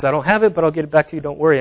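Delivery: a lightly voiced, mock-anxious debtor begging for time; Julie pleading. Julie, owing fifty dollars, pleads with the preacher in a mock-anxious voice.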